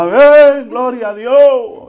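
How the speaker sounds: high, loud cry of amen; congregation shout. Retold or imitated. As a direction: shout it like the whole church. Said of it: "aquí todo el mundo grita".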